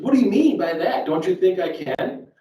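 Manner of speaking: upset retort. Retold or imitated. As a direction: push back, stung by the joke. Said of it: "she got really upset with me".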